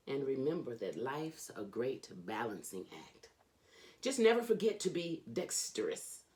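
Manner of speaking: lively, sing-song reading of a rhyming picture book; animated children's book read-aloud; storybook reading. Acted. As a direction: read it like a bedtime story for a kid. Reads Dr. Seuss aloud.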